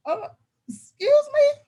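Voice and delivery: high, loud questioning retort; mock affronted disbelief. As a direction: act out a shocked teacher's challenge, pitch leaping up. Teacher reacts to student's cussing.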